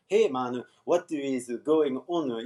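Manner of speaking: slow Japanese katakana accent, syllable by syllable; Japanese-accented English with vowels added after consonants. Shown as a demonstration. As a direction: imitate a learner's stiff katakana English. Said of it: "translating into katakana English".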